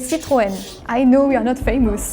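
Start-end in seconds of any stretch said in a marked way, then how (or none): 0.0-0.5 "Citroën" as "see-tro-EN"; French pronunciation. Shown as a demonstration. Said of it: "In French".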